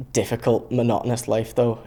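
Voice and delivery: usual speaking voice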